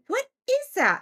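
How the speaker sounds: high-pitched imitation of a puzzled partner; puzzled Barry. Woken housemate asks about the noise, high puzzled voice. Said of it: "And Barry's like".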